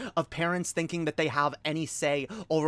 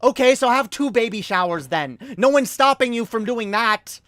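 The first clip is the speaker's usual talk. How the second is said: louder, higher, fed-up comeback; exasperated retort; 6 semitones higher, 7 dB louder than usual. Real, not acted. Answering a selfish relative, loud exasperated retort. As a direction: snap the obvious fix back at them.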